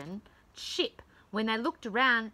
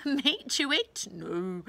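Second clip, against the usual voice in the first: plain reading voice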